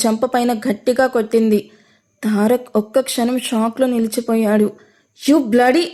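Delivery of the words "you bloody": plain reading voice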